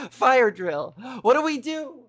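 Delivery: startled and loud. Real, not acted. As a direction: caught off guard by a drill. A sudden fire drill.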